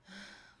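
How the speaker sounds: soft, tired exhale; weary sigh. Real, not acted. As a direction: let out a real, quiet sigh of exhaustion. Tired sigh near end of work.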